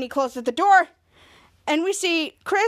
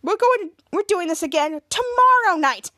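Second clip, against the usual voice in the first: indignant, higher-pitched ultimatum; angry; 6 semitones higher, 3 dB louder than usual. A narrator's reading. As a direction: lay down the law, rising in pitch as you go. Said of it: "so angry".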